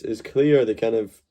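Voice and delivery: usual speaking voice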